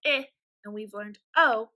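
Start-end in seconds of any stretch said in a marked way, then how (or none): 0.1-0.3 "I" said as a short i, as in "it"; one short vowel for "I", not the letter name; short I sound. Shown as a demonstration. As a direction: model "I" as "ih". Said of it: "which says".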